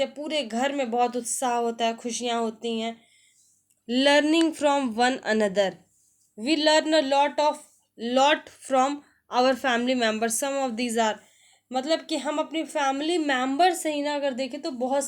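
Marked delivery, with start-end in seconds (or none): 3.9-11.1 slow, careful English read-aloud; slow textbook reading. Shown as a demonstration. Teacher reading the family lesson to students, slow and deliberate. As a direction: spell it out slowly for learners.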